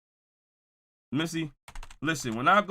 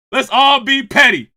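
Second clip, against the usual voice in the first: slow laughing call to be petty; 5 semitones higher, 8 dB louder than usual. Real, not acted. Host laughing as he rallies the chat to pettiness. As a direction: laugh it out, drawn out and loud.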